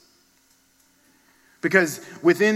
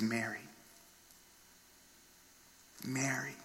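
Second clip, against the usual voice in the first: tender name-calling; 15 dB quieter than usual. Acted. Risen Lord calling Mary by name, hushed and tender. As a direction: call the name very softly, leaving space around it. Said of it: "she heard his voice calling her name".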